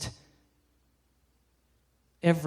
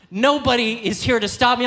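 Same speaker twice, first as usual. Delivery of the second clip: ordinary delivery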